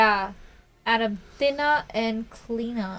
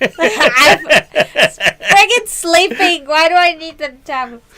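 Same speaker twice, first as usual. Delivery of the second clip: loud, laughing mock outrage; laughing while yelling; 7 semitones higher, 13 dB louder, slower than usual. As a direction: yell it through laughter. Quoting a funny meme.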